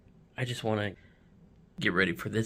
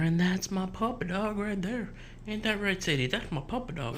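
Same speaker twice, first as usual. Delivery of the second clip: much higher, softer pet voice; 9 semitones higher, 4 dB quieter than usual. Real, not acted. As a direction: coo it to your puppy. Talking to the dog.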